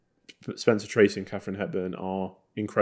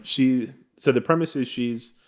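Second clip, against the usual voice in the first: ordinary talk, nothing marked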